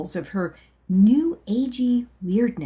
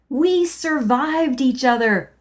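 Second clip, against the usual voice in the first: higher, louder, joyful exclaim; happy outburst; 6 semitones higher, 5 dB louder than usual. Acted. Hugging his old tormentor, a joyful higher, louder outburst. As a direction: burst it out with glee during a bear hug. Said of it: "happily proclaimed".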